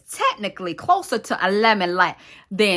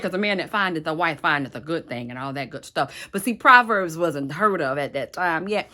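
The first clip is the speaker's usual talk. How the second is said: usual speaking voice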